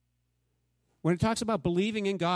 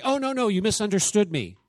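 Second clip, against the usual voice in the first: mock backpedaling; 5 semitones higher, 6 dB louder than usual. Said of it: "Jesus doesn't say".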